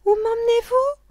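put-on very high, loud child's voice; high-pitched little girl's question. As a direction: ask it as an alarmed little girl. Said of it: "demanda la petite fille".

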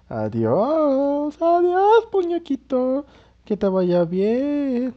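mocking farewell.

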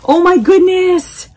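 high, thrilled exclamation, pitched well up; delighted gasp. Real, not acted. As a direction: squeal it out at the gift. Delighted at the cat nightlight.

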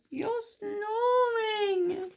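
high, very drawn-out squeal; complaining Little Mouse. A narrator's reading. Little Mouse complains to snoring Big Mouse, high slow wail. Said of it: "he called".

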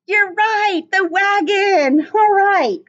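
light teacher-style excitement; mock delighted praise. Praising imagined pupil answer.

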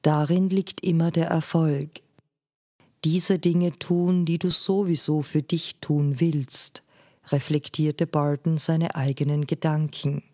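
ordinary delivery

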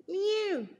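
high cat cry. A narrator's reading. Cat crying at the shop door. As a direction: mew high and pleading like a cat. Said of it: "cried Simpkin".

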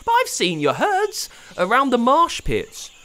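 high, startled protest; disbelieving, pushing back; lightly voiced shocked boy. A narrator's reading. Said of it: "Pablo was shocked".

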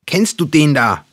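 high, loud, accusing yell; yelling at her. A narrator's reading. Youngest brother confronts his sister, yelling. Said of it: "schrie der Jüngste sie an".

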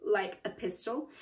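usual speaking voice

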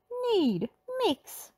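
high quick commands; Shaggy voice. A narrator's reading. Shaggy giving baking orders in a high voice. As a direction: bark the baking steps. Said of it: "Shaggy shouted".

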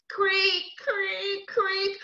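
high, stretched creaking noise; strange rope creak. Acted. Storyteller voicing the old swing rope, high creaks. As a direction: imitate an old rope straining, pitched high. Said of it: "began to make a very strange noise".

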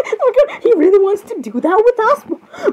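thrilled squeal. Acted. Girls thrilled he wants to meet them, squealing. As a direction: squeal with laughter, then draw out the question. Thrilled reaction to repeated line.

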